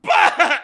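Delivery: high, loud sudden laugh-bark; startled burst of laughter. Retold or imitated. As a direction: blurt a shocked laugh. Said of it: "and she goes".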